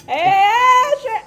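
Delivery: high, loud call across a distance; screaming. Acted. Traveler spots Azure by the fence, screaming out to him. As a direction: holler to get a friend's attention. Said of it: "I'm screaming".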